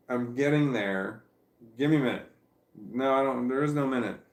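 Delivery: half-playful bickering with her own lazy side; mock self-argument. Telling herself to get out of bed, mock scolding.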